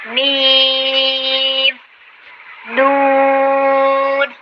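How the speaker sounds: میم and نون held long, kept out of the nose; clear meem and noon. Shown as a demonstration. Teacher demonstrating letters without nasal sound, drawn out. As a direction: draw out میم, then نون, cleanly. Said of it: "نون کی آواز بھی ناک میں چلی جاتی ہے".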